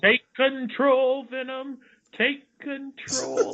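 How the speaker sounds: hammed-up high-pitched call; mock high voice. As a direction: squeal it like a cartoon sidekick. Mimicking trailer/cartoon voice.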